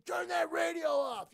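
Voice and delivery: high, loud, slow shout; retold yell. Rear-ended driver yelling at the teen over blasting music, shouting. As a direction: bellow it like a furious driver. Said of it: "he's yelling at me".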